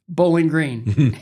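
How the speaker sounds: slow, loud, drawn-out delivery; emphatic announcement. Retold or imitated. Skater pitching his hometown in two words, emphatic announcement. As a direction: declare it like a grand reveal.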